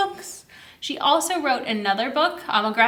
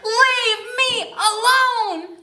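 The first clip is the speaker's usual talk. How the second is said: high, drawn-out shout, very slow; old woman shouting; 10 semitones higher, 4 dB louder, much slower than usual. Acted. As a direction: stretch out the shout as loud as you can. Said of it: "the old woman shouted".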